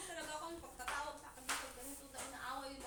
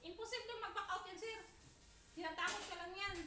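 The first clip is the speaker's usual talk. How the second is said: raised voice at boss; 6 semitones higher than usual. Retold or imitated. Maid retelling how she raised her voice at her boss. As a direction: push back hard at sir. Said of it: "Nilakasan ko talaga yung boses ko".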